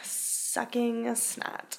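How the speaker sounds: very slow teasing drawl of Sucking snot; drawn out slowly. Acted. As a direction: stretch every sound to tease. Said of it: "Please don't say "sucking snot" at me".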